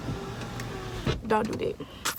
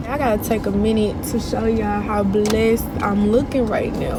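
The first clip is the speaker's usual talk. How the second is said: usual speaking voice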